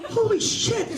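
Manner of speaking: loud, very high shocked blurt; shocked exclamation. Retold or imitated. Shepherd sees Jesus beheaded and blurts out in shock. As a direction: gasp it out in horror. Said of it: "said one of the shepherds".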